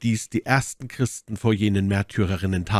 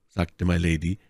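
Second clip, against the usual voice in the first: usual speaking voice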